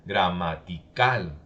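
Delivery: "gramatical" very slow, landing hard on CAL; syllable-by-syllable gramatiCAL, last one stressed. Shown as a demonstration. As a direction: stretch the word and punch the final A of "gramatical". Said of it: "en la última A".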